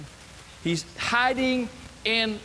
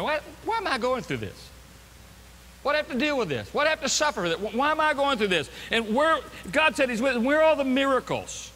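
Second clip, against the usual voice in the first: higher-pitched, aggrieved questioning; exasperated protest; 6 semitones higher than usual. Acted. Doubter complaining to God about suffering, exasperated protest. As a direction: demand answers, building in force.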